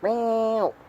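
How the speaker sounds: high-pitched imitated cat meows; sing-song mock meowing at a pet. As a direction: meow up high to call the cat. Calling to the cat.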